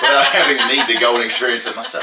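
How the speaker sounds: laughing. Real, not acted. Punchline of a review read aloud.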